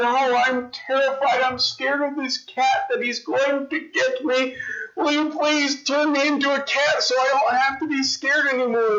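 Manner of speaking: high, pleading little mouse voice. Acted. Mouse begging the wizard to make it a cat, high and pleading. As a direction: beg the wizard as a tiny frightened mouse. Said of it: "the mouse said to the wizard".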